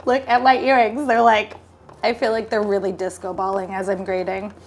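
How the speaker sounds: ordinary talk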